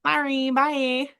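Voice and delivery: high, slow, drawn-out farewell; sweet brush-off. Retold or imitated. Waxer gets out of giving her number, high mock-polite goodbye. Cheery dismissal of client, retold.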